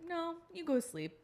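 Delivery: put-on high, slow toddler order; little boy voice. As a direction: boss her like a little boy. Son dismissing mom.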